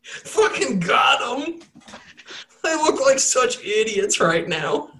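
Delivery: high, slow mock gloating; gloating trickster. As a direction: crow over the fooled party in a put-on voice. Acting the trickster gloating afterwards.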